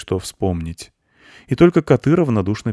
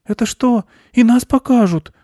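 put-on very high, louder girl's voice; eager girl; 7 dB louder than usual. A narrator's reading. Girl asks if they will be shown too, eager high voice. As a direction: squeak the question out eagerly. Girl excited about being filmed.

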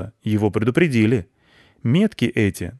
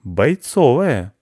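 cat clarifying; 5 semitones higher, 4 dB louder, much slower than usual. A narrator's reading. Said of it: "уточнил кот".

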